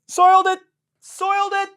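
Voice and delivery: very high, squeaky mock outrage; high cartoon squeal of disgust. Ranting about Tigers loss.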